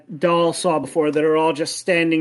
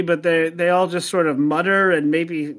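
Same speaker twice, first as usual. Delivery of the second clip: ordinary talk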